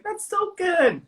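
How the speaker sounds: imitating a sweet wife, very high-pitched; sweet encouragement. Wife praising partner's French, imitated high sweet gushing. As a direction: gush sweetly in a mock high voice. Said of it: "she's so sweet".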